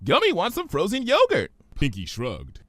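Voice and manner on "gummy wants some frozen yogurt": much higher, bubbly pony; Pinky's voice. Acted. Pinky puzzled by Twilight's big word, high voice.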